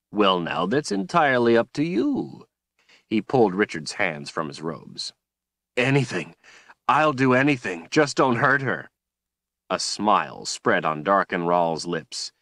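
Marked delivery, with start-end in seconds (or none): 0.1-2.5 a lightly voiced silky villain; mildly voiced cold, pleased tyrant; smug villain. A narrator's reading. Villain Darkenral speaks. 5.8-8.9 desperate pleading. A narrator's reading.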